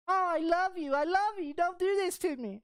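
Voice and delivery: high, pleading mock girlfriend; high girlfriend voice begging. Narrator imitates girlfriend begging him to stay, high pleading voice.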